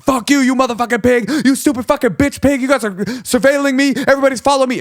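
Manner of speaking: screaming high and loud; methed-out rage. Retold or imitated. Interpreter voicing a furious deaf caller's screaming. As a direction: shriek the abuse at the cop. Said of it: "he's like, methed out".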